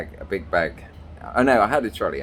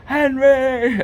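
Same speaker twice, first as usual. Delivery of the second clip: high, loud call across the hall; shouting the dog's name; 8 dB louder than usual. Retold or imitated. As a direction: call out to a lost dog far away. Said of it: "as soon as I shouted".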